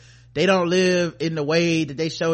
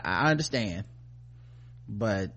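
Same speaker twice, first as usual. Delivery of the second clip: ordinary delivery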